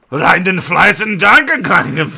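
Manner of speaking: exaggerated shouty German reading; mock German accent. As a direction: bark out a bad German accent. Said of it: "actually read it in a German accent".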